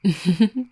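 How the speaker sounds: short teasing laugh; amused chuckle. Acted. Vampire teasing partner about falling asleep, amused chuckle. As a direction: give a quick, light chuckle.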